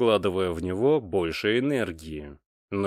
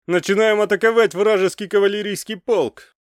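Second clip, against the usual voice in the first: high, loud battle shout; shouted order; 12 semitones higher, 8 dB louder than usual. A narrator's reading. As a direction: shout the attack order across the field. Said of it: "прокричал командир". (громкий боевой крик)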